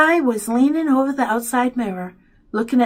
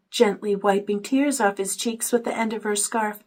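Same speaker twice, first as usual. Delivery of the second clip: usual speaking voice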